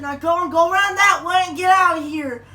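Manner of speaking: bossy neighbor. Retold or imitated. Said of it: "And he said".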